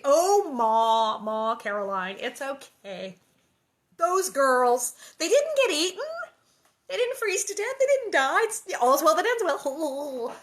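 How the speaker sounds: loud, hammed-up father brushing off worry; improvised Pa. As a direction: start with a big "oh", climb high in mock reassurance. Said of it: "He was probably saying".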